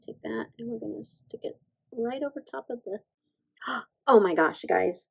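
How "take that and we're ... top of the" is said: whispering while concentrating; hushed, under the breath as she works. Real, not acted. Whispering to viewers while placing the stamp.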